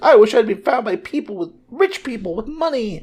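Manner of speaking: very high, put-on eight-year-old brat; spoiled little brat. Adopted girl doubling down at her mother, imitated spoiled brat. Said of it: "It's a spoiled little brat".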